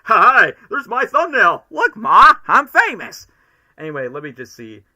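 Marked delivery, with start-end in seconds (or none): 0.1-3.3 much higher and louder, a mock-proud shout; playful excitement. Real, not acted. Sees his thumbnail in chat.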